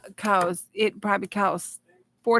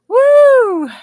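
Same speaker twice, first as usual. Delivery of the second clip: very high, loud whoop of joy; excited whoop; 14 dB louder than usual. Real, not acted. Streamer cheering her five hundred milestone, high loud whoop. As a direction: let out a big celebratory cheer.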